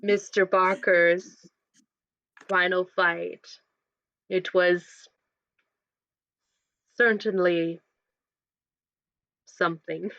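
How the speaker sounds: low, slow, gravelly doctor. Acted. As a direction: drag it out low and rough. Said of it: "the gravelly voice of Doctor Moore".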